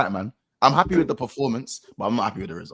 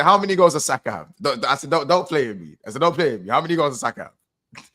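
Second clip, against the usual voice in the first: loud, pressing disbelief; insistent disbelief; 3 semitones higher, 6 dB louder than usual. Retold or imitated. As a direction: demand it, can't believe it.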